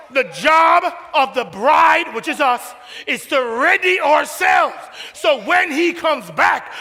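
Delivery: loud, rising preacher's cry; preaching at full force. Real, not acted.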